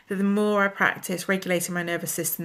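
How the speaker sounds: ordinary delivery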